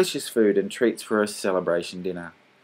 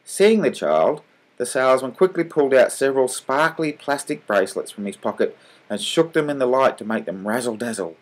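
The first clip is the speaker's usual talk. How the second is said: ordinary delivery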